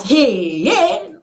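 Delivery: mock shout, leaping up high on the second hey; retold shout. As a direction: act out a startled cry without full volume. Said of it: "I will just shout".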